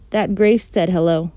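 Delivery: ordinary delivery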